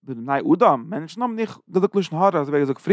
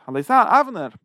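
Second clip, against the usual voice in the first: calling out; 6 semitones higher than usual. Retold or imitated. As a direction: call out to Avner from the hilltop.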